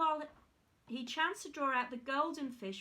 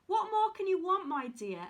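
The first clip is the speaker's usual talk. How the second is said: a touch of joy, lifted and a little louder; joyful cry; 5 semitones higher, 5 dB louder than usual. A narrator's reading. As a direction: cry it out gladly to her husband. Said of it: "cried joyfully".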